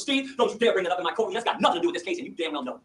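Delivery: the judge scolding the attorney in court; imitating an angry judge. Judge snaps at the attorney in court, mock stern. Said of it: "and her voice goes".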